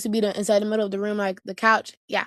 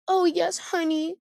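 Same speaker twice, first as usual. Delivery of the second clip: sweet mom; 6 semitones higher than usual. Retold or imitated. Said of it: "And then Mom says".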